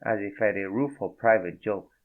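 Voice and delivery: ordinary delivery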